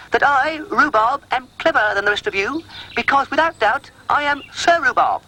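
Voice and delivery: high, lordly dog voice; pompous Rhubarb. A narrator's reading. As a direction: proclaim it grandly. Pompous speech to animals.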